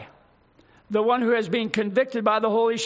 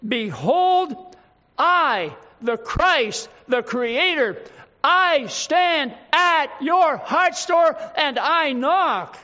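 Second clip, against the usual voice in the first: building to a louder, slower "I stand at your heart's door"; louder, higher, slow preaching peak; climactic proclamation; 3 semitones higher, 3 dB louder, much slower than usual. Shown as a demonstration. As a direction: rise to a slow, ringing climax on "I knock". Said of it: "When verse twenty says".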